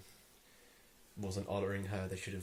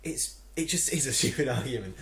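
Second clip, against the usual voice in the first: loud, emphatic insistence, pitched up; exasperated outburst; 4 semitones higher, 9 dB louder than usual. Real, not acted. Vlogger ranting about a silly argument, loud and exasperated. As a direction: burst out louder in exasperation.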